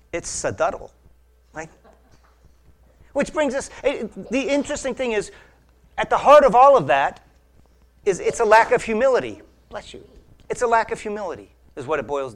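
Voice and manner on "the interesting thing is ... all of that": ordinary delivery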